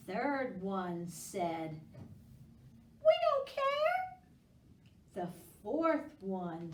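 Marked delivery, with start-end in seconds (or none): 3.0-4.2 very high squeaky don't-care; squeaky pumpkin. Acted. Teacher squeaking a careless pumpkin for kids.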